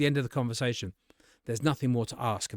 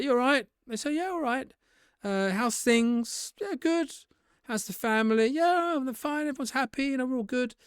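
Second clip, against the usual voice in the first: much higher, breezy banter; casual mates chatting; 11 semitones higher than usual. Shown as a demonstration. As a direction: toss it off like greeting old friends. Casual chat between friends.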